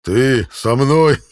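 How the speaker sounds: slow, loud scornful disbelief; scornful knight. A narrator's reading. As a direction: drag it out in contempt. Knight mocks the challenge.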